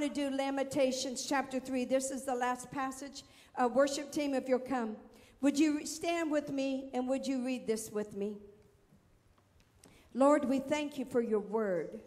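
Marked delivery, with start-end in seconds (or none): none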